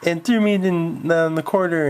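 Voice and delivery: high, whining complaint; whiny tattling. Acted. Roy complains to the math teacher, whining. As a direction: tattle to the teacher like a scared kid. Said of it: "He said".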